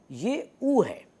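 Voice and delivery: ऊ drawn out very slowly; long u demo. Shown as a demonstration. Teacher brings in the new sound slowly for the class. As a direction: stretch the ऊ in ये ऊ है long and slow. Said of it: "वाव जो long वावेल है".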